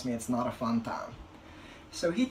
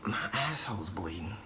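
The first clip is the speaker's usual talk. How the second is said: hushed whisper so the waiting room can't hear; embarrassed whisper to the receptionist; whispered confession; 3 semitones lower, 8 dB quieter than usual. Retold or imitated. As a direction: lean in and whisper it. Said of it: "lean in and whisper it".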